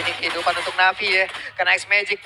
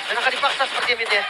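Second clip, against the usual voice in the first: normal talking voice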